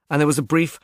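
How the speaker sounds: ordinary delivery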